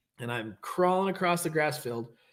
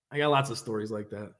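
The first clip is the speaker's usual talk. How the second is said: ordinary talk, nothing marked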